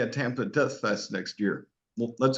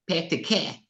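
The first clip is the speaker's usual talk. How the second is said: put-on Boston accent; 6 semitones higher than usual. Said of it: "talk like you're from Boston".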